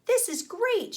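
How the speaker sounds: high, loud delighted cry; exclaimed. A narrator's reading. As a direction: squeal with joy. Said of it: "she exclaimed".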